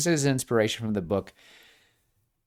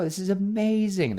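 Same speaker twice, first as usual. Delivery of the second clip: much higher, delighted exclamation; gushing mom; 11 semitones higher than usual. Retold or imitated. Mom reacting to the guest show news, much higher and delighted. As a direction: burst with delight. Mom gushes over success.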